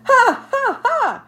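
high, loud laughing ha ha ha; father laughing at the squirrel's name; longer laugh. Acted. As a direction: laugh out freely, with relief. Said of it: "he laughed longer".

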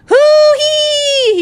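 very high, loud whoop of joy; gleeful shout. A narrator's reading. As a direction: whoop it out, carried away. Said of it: "he shouted with glee".

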